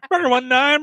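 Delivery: loud radio call sign. Pretend.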